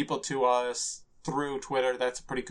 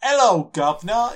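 Cockney accent; 7 semitones higher, 8 dB louder than usual. Shown as a demonstration. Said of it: "is the easiest one to do".

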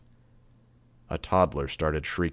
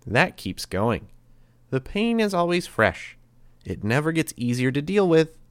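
put-on higher, lilting, sweetly menacing boy; creepy cheerful threat; 6 semitones higher, 3 dB louder than usual. A narrator's reading. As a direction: toy with the captives in a higher voice. Grey Boy describing the punishment.

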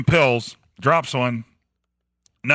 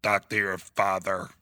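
low, slow robotic growl; a shaky attempt at Hector's voice, low and slow; 5 semitones lower, 7 dB quieter than usual. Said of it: "It sounds more like a shitty robot".

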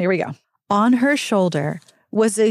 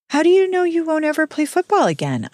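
put-on higher, earnest girl's question; lightly voiced girl; 8 semitones higher than usual. Jenny asking Mark about football, higher earnest. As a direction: ask it bravely, reaching up.

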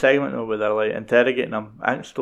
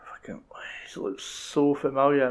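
hushed, breathy mutter of recognition; retold whisper of a man spotting a familiar face; whispered aside; 3 semitones higher, 4 dB quieter than usual. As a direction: whisper it like you've just clocked someone. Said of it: "And he's like".

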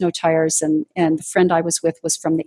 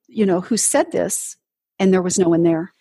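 normal talking voice

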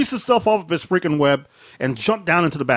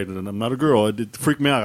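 ordinary delivery

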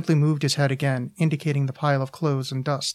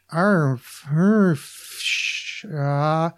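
garbled speech noises; much slower than usual. A narrator's reading. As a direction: imitate someone yelling words you can't make out. Girlfriend's words come through unintelligible.

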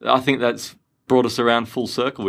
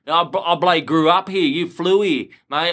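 mocking a proud true-blue Aussie, broad Australian accent; ocker Australian bloke; 5 semitones higher than usual. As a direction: ham up a broad Australian mate. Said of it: "you know how people go".

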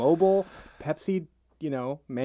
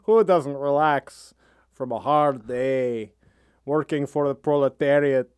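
put-on Russian accent. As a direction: savour the shoe wax like a tired Soviet worker. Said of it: "you can do a Russian accent".

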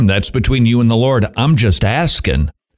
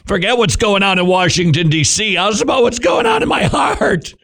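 higher pitch climbing to a strained cry; impassioned exclamation; 7 semitones higher than usual. Acted. Preacher turning from Washington to his own heart, exclaiming. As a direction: build to an outcry at the end.

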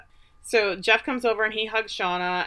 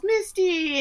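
high-pitched happy call; mock yell; 12 semitones higher than usual. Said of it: "And [name] yells".

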